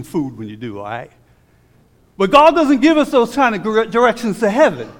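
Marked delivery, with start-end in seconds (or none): 2.2-4.9 loud, high emphatic declaration; preacher shouting a correction; preaching shout. Real, not acted. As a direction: belt it out to the pews.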